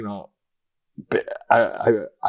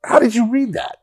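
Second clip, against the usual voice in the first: incredulous question; 13 semitones higher than usual. Retold or imitated. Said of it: "and I go".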